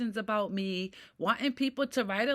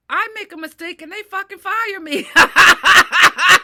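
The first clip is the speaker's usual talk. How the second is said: bitter laughing rant; 6 semitones higher, 18 dB louder than usual. Real, not acted. Bitter rant about being fired.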